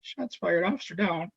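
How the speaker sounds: high but hushed imitation of a yell; retold radio yell. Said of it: "she yells".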